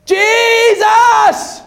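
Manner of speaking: high, desperate shout to Jesus; acted blind man's cry. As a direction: shout the name high and long. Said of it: "He cried louder".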